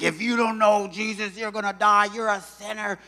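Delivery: high, loud street-preacher yell; bullhorn yelling. Retold or imitated. Street preacher shouting at passers-by, loud and high. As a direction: bark it through a bullhorn. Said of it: "they're just yelling at me".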